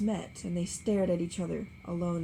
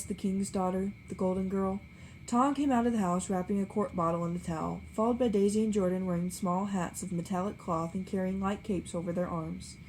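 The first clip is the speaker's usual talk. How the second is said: plain reading voice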